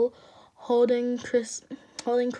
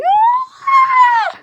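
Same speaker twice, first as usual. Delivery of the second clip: very high loud wail; crying wail; 14 dB louder than usual. Real, not acted. Reader breaking down over the apology, wailing. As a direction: burst into a long squealed cry. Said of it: "I'm actually crying".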